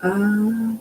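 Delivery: ordinary talk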